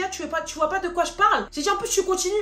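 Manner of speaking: high, heated comeback in a retold quarrel; indignant retort. Confronting Lina on the phone, indignant.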